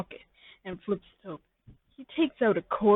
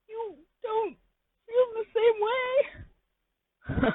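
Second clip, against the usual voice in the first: very high, slow crying whine; much slower than usual. Acted. The listener asking Pimpich if he feels the same, in a slow high wail.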